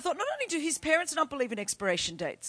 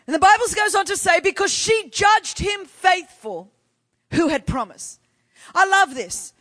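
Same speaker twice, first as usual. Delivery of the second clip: raised, forceful pulpit delivery; impassioned preaching; 4 semitones higher, 6 dB louder than usual. Real, not acted. Preacher telling the church of Sarah's promise, impassioned. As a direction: drive each phrase home to the congregation.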